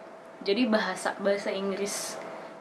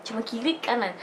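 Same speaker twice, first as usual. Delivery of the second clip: ordinary talk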